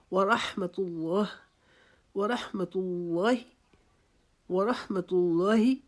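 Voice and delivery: ورحمت اللہ slowly with a clear حمت; slow recitation. Shown as a demonstration. Teacher drilling ورحمت اللہ slowly. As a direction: stretch ورحمت اللہ, no رح break. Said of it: "رح نہیں پڑھنا".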